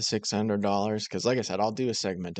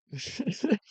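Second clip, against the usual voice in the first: sudden high-pitched burst of laughter; 10 semitones higher than usual. Real, not acted. Host laughing at the uncle's remark. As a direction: let a high laugh escape. Reacting to Uncle Brian clip.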